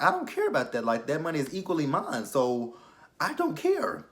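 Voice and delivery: I don't care, said up high and heated; indignant. Retold or imitated. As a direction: throw it back, fed up. Speaker's own outraged reply.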